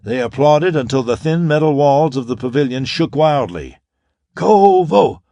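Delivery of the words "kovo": loud, high chanted cheer; crowd roar. A narrator's reading. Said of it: "the roar went up".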